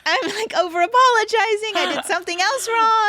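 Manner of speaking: high, loud mock wail; mock crying. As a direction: wail it as if bawling, hammed up.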